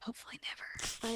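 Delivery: whispered aside; a quick wry whisper. Real, not acted. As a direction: mutter it under your breath.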